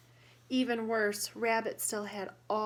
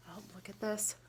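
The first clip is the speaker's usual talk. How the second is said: softer whispered aside; 5 dB quieter, faster than usual. Real, not acted. Teacher pointing out a picture to children, whispered. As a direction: hush to show the picture.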